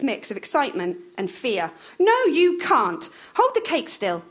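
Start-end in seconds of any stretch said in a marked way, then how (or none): 2.0-4.2 higher, louder scolding wife; bossy wife snapping at Roy; Maud scolding. A narrator's reading. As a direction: nag him to hurry up. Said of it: "said Maud".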